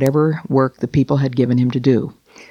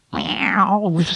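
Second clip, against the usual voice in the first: a mild, higher spoken meow; cat's meow; 5 semitones higher than usual. Acted. Simpkin the cat answering the sleeping tailor, mild meow. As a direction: give a small cat's meow. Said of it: "Simpkin said".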